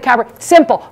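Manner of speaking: ordinary delivery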